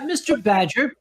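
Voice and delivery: ordinary delivery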